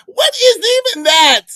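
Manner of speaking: loud, high, baffled wail; appalled disbelief. Real, not acted. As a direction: cry out in total bewilderment. Baffled by phrase in the clip.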